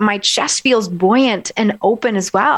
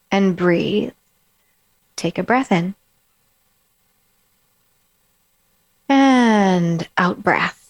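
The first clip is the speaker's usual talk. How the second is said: guided breathing; slower than usual. Shown as a demonstration. Coach leading listeners through calm breaths. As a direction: breathe with them as you speak. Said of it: "Do that with me".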